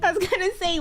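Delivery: high, giggly, struggling to speak; laughing. Real, not acted. Player trying to explain their line, laughing. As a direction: squeeze the words out through real laughter. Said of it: "I'm laughing so much".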